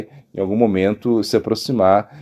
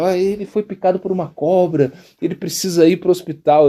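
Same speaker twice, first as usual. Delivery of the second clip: higher-pitched mock appeal for help; retold urgent plea; 6 semitones higher than usual. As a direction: plead with passing drivers, lightly acted. Pleading with passing drivers for help. (apelo urgente, em tom de relato)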